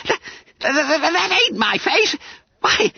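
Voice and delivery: high stuttering squeal; stammer, then wail in dismay; spluttering horror. A narrator's reading. Bunter discovers his blue face in the mirror, spluttering. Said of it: "spluttered Bunter".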